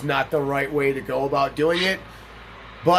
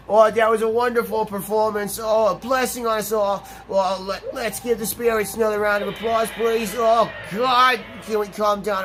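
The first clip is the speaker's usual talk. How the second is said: higher, excited announcer voice of a game character; hammed-up showman; 6 semitones higher than usual. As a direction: oversell the applause like a gushing host.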